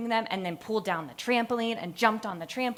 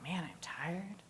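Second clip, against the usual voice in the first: tired whisper; 3 semitones lower, 11 dB quieter than usual. Retold or imitated. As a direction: barely breathe out how worn out you are. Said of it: "I was like".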